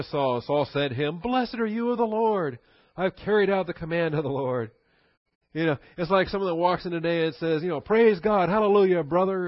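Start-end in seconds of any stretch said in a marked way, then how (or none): 1.2-4.7 high, proud greeting, showing off; boastful Saul. Retold or imitated. Saul boasting to Samuel he obeyed, high and proud. 7.8-9.5 high, loud mock churchy enthusiasm; mock hallelujah excitement. Mocking a showy churchgoer, loud high praise. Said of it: "and all this other excitement".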